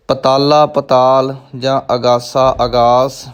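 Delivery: ਪਤਾਲਾ and ਅਗਾਸਾ begun with short a; wrong short vowels. Shown as a demonstration. Teacher shows learners faulty readings, short first vowels in ਪਤਾਲਾ, ਅਗਾਸਾ. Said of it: "ਪਾਠ ਨੇ ਅਸ਼ੁੱਧ ਨੇ".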